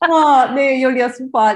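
bursts out laughing, loud and high; real laugh breaking into the reply. Real, not acted. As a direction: laugh through the words. Laughing reaction at interview end.

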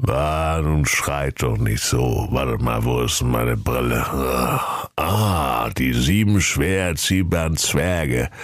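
low, doddery tortoise voice; grumbling old tortoise. Acted. Old tortoise squints at the dwarfs, low and grumbly. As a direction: fumble along like an ancient, low-voiced creature.

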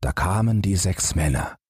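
plain reading voice

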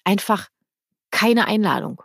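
ordinary talk

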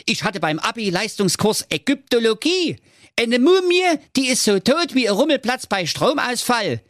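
high, boastful show-off prince; cocky prince voice. Acted. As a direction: brag like a vain young prince. (angeberischer Prinz)

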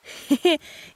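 put-on high, loud creepy little girl laugh; eerie child giggle. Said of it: "das lachend sagte".

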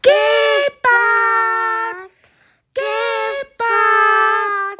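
high, stretched call for the cheetah; calling out loudly. Acted. Hare calls cheetah in the savannah, long high shout. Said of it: "Und dann ruft es ganz laut".

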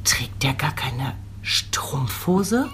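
sehr langsam geflüsterte Frage; zischelnd-langsam nachgeäffte Bekannte; leise zitierte Bekannte. Retold or imitated. Kritische Bekannte blickt in Kinderwagen. (die Bekannte beim Blick in den Kinderwagen nachäffen, langsam geflüstert)

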